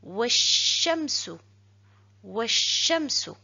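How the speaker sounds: Washamsu read slowly, joined with no L sound; slow Arabic reading. Shown as a demonstration. Teacher reads the joined Arabic slowly for the class. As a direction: draw out Washamsu slowly, linking the wa. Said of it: "Et je lis".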